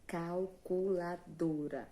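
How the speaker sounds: "Calculadora" slowly, each syllable distinct but joined; measured, evenly beaten "Calculadora". Shown as a demonstration. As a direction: spell out the beats of "Calculadora" slowly. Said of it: "Cinco vezes eu precisei abrir minha boca".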